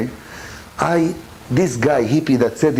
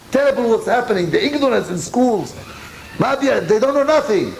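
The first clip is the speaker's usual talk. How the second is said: higher, urgent lament; impassioned; 7 semitones higher than usual. Real, not acted. Rabbi lamenting ignorance in schools, impassioned and higher. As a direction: rise up in alarm at the ignorance.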